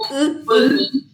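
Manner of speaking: y marcada, alta y fuerte, sonido aislado; aísla la y y exagérala; pronunciación de la y. Shown as a demonstration. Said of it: "Este es y siempre". (la profe muestra al alumno cómo suena la y)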